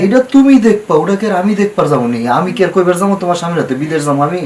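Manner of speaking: high, loud, insistent dismissal; loud protest. Acted. Lender dismisses borrower's fear of her husband, loud protest. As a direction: brush the worry off forcefully, voice jumping up. Lender brushes off secrecy worry.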